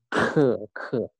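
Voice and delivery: "ke" said with a clear puff of air; aspirated k. Shown as a demonstration. Teacher has learners test with a hand, puffed "ke". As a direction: push breath out on each "ke". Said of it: "Kalau ada udaranya".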